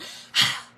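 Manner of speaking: a loud, breathy huffed sigh; loud imitated huff. Imitating the coworker's loud huff for viewers, a big exasperated sigh. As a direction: blow out one big annoyed huff. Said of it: "it was a loud huff".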